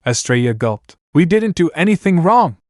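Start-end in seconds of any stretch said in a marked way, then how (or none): 1.1-2.6 raised, pitched-up protest; half-scream of innocence; mild scream. Acted. Hecuba protesting to the box, mild scream. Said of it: "Hecuba screamed".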